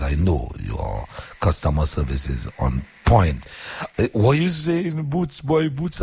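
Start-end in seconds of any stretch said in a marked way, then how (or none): 4.0-6.0 high, slower put-on caller voice; mimicking a caller. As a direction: play the excited caller, pitched way up.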